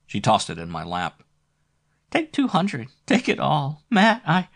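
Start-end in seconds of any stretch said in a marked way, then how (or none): 2.1-4.5 high, pleading lover; eager sexy voice. Acted. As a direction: gush it out eagerly. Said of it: "Flo's sexy voice".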